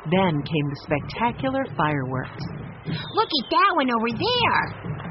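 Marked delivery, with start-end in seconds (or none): 3.1-5.1 put-on high, slow excited boy's shout; child calling out at the sky; Benny shouting. A narrator's reading. Benny shouts to his family at the fireworks, high and excited. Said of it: "Benny shouted".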